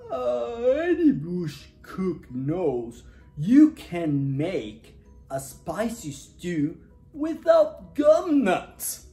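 loud, slow, drawn-out yawny voice; yawning koala. A narrator's reading. Sleepy koala advises Dingo on gum nuts, yawning. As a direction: drag the words out through a yawn. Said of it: "he yawned".

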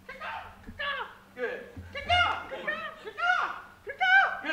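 very high squawking caw-caw; macaw call. Acted. Teacher voicing the macaw for the game. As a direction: screech it like a parrot. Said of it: "the macaw gets up and says".

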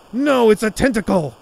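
alarmed yell at the tentacle; panicked shout. Acted. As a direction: cry out in sudden fright.

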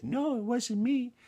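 high, slow mama lamb voice; the high mama lamb take of the denial. Acted. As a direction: lift way up into a sweet mama lamb denying it. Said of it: "And the mama lamb said".

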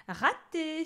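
high taunting cry; mocking. Boy dodging the lightning, mocking. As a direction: taunt the witch. Boy mocks missed spell.

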